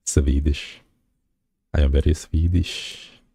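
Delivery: ordinary talk, nothing marked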